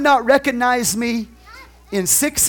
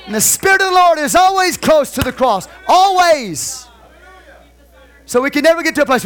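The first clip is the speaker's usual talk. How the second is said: loud, higher shouted preaching; preaching at full force; 7 semitones higher, 5 dB louder than usual. Real, not acted. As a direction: drive it home to the church.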